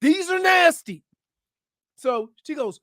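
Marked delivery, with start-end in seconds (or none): 0.0-1.0 exasperated parent. Retold or imitated. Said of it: "I'm like".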